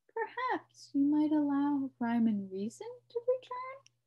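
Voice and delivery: ordinary delivery, nothing marked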